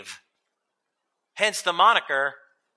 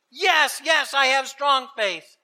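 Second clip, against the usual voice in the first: high-pitched, urgent assent; desperate eagerness; 6 semitones higher than usual. A narrator's reading. Tourist assures the voice he believes, desperately eager. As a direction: agree instantly to get rescued.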